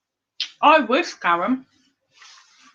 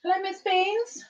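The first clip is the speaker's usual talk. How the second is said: cooing to the cat; 9 semitones higher, 5 dB quieter than usual. Real, not acted. Greeting her cat.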